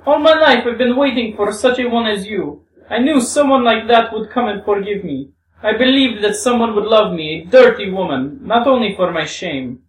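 high, loud, fervent confession; impassioned, high-pitched adoration; Grushenka, beside herself. Acted. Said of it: "as if beside herself".

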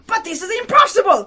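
high, loud protest; alarmed cry. Acted. Short young man protests at the commissioner's outburst, crying out. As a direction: cry out in objection. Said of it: "cried the short young man".

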